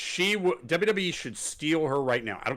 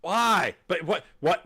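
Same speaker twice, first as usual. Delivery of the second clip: normal talking voice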